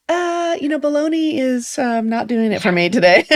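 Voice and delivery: high, hammed-up whiny husband; high, teasing put-on voice complaining about bologna for lunch; mock husband voice. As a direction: play the picky husband for laughs.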